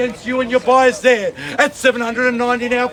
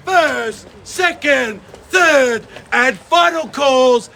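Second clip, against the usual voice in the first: auctioneer shout; 2 semitones higher, slower than usual. Real, not acted. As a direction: rouse the crowd to bid. Auctioneer rallying crowd.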